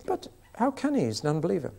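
high, incredulous pleading. Retold or imitated. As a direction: squeak her objection up high. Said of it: "I said".

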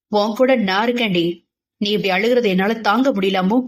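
ordinary delivery, nothing marked

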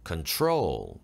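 low "Control", stress on "trol"; light "Con", long falling "trol" in "Control"; weight on the second syllable of "Control". Shown as a demonstration. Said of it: "둘째 음절에 있고".

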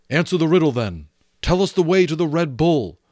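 ordinary delivery, nothing marked

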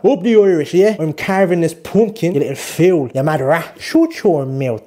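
put-on Irish accent, mock scolding. Shown as a demonstration. Vlogger scolding the pumpkin in a mock Irish accent. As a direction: play a slow, cross Irish speaker. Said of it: "I think my Irish accent is better".

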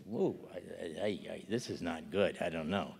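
preacher voicing his own awed dismay; stunned hush. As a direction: trail off, shaken and at a loss. Acted stunned reaction to revelation.

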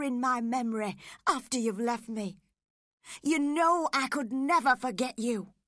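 passionate, rising indignation; impassioned protest. Acted. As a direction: start contained, then burst into loud protest. Heathcliff protests her cruel words.

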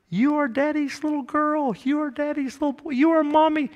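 put-on high, soft baby-talk; tender, hushed sing-song pitched well up; cooing parent. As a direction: imitate a parent cooing to a newborn. Parent talking to newborn baby.

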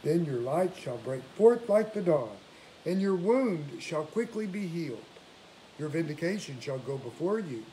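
ordinary talk

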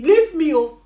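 ordinary talk, nothing marked

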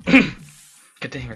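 ordinary delivery

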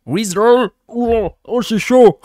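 Rizzler shouted high, as if with a hot potato in the mouth; hot-potato accent. Shown as a demonstration. Streamer demonstrating English with a hot potato, loud mock accent. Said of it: "il faut avoir une patate chaude dans la bouche".

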